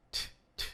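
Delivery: "t, t" as bare unvoiced tongue taps, no vowel; voiceless t demo. Shown as a demonstration. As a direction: tap out a breathy "t, t" for articulation. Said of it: "lembre de t".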